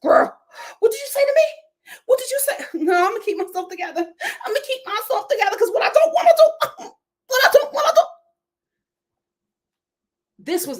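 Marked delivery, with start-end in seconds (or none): 0.0-2.7 high squeaky mock outrage; imitating a hammed-up angry castmate, pitched way up; mock Todd. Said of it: "Todd's like". 2.8-5.6 fast, higher, laughing through the fake calm; rattling off mock self-restraint; mock Todd. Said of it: "Todd's like". 5.6-8.0 squealed very high and loud, laughing; hammed-up squeaky threat breaking into laughter; mock Todd. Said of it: "Todd's like".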